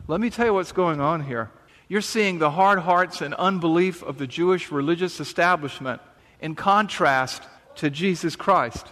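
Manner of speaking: normal talking voice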